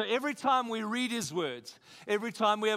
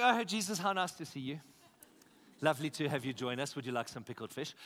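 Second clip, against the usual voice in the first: mock polite welcome; 6 semitones lower, faster than usual. Preacher voicing disciples calmly welcoming Jesus to join them, mock-polite. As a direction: play unbothered small talk for comic contrast.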